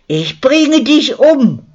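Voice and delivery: high, loud and slow furious roar; bellowing, beside herself. A narrator's reading. Luzi beats the attacker in the street: furious high roar. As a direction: roar it out in blind rage. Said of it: "brüllte sie außer sich".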